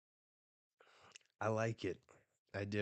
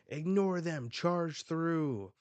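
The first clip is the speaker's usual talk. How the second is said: high, loud pirate captain yell; 8 semitones higher, 5 dB louder, slower than usual. Acted. Captain yelling to his crew to charge through. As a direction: shout the order to charge. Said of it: "Rox yells".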